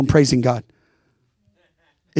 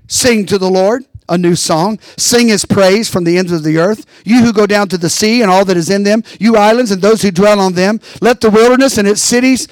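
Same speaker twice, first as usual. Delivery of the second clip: louder, ringing scripture reading; preaching; 3 semitones higher, 3 dB louder than usual. Acted. Pastor reading Isaiah to the congregation, preaching. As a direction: preach the verses out loud to the church.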